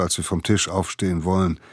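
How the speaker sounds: plain reading voice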